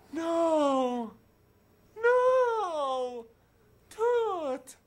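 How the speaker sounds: long, high, loud wails; wailing. Real, not acted. Player watching Toot lose the human shape, loud high wail. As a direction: cry out in drawn-out dismay.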